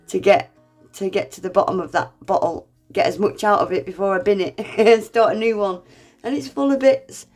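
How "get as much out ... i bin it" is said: normal talking voice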